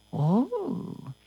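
ordinary delivery

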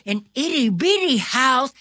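usual speaking voice

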